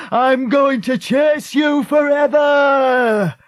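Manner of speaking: slow, high, loud howl of menace; screamed threat. A narrator's reading. Man left in the river to fleeing girls, screamed threat. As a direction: scream it across the water, stretching every word. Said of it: "he screamed after them".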